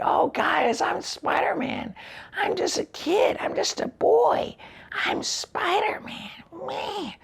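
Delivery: exaggerated squeaky falsetto, drawn out; mock a little boy hero in very high, thin falsetto; Spider-Man. Reviewer mimics the hero's kid act, slow squeaky falsetto. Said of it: "talking like this".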